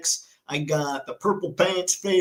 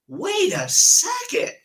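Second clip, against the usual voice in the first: surprised; 3 dB louder, slower than usual. Real, not acted. Startled by news while reading chat.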